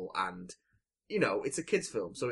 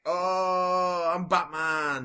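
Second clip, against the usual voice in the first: put-on excited kid rambling; 5 semitones higher, 9 dB louder, much slower than usual. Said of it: "is like".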